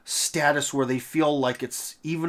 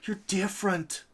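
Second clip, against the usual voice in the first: very high, singsong no no no no no; mock flatterer; 13 semitones higher, 4 dB quieter than usual. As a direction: coo it like a patronizing foreigner. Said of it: "by foreign powers that".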